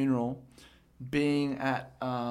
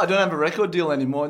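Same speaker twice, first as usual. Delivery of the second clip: loud mock disbelief; 3 semitones higher, 8 dB louder, faster than usual. Mock shocked denial.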